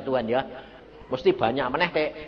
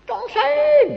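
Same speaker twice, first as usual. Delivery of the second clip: much higher, loud shout of a name; shouted call; 7 dB louder than usual. Retold or imitated. God calling the sleeper at night, shouting. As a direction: yell the name across the room. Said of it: "teriak lagi".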